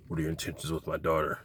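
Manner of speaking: lightly voiced stern father; low quick mock dad question; gruff overprotective dad. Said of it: "he's like".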